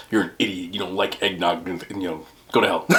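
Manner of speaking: low, soft mock insults; imitated rude commenters. Commenters scolding him over eggnog, low soft imitation. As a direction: rattle off imitated hate comments under your voice.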